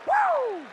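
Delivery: high, loud whoop of joy; celebratory whoop. Real, not acted. Player celebrating on the field, whooping loudly. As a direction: let out a happy yell.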